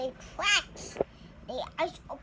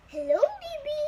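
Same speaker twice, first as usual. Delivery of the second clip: high, louder cooing hello; mama greeting baby; 11 semitones higher, 4 dB louder than usual. Acted. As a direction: greet the baby sweetly like a mother.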